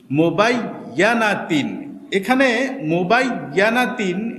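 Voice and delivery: মুবাইয়ানাতিন spoken slowly, every syllable clear; slow model reading. Shown as a demonstration. Teacher reading মুবাইয়ানাতিন for students to copy. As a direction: draw out মুবাইয়ানাতিন evenly. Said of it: "তো আসুন আমরা শুরু করি".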